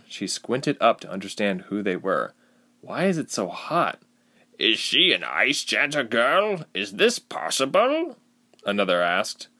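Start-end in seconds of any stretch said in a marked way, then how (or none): 2.8-8.2 high, slow questioning villager voice; astonished villager. A narrator's reading. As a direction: wonder aloud at the stranger, pitched up.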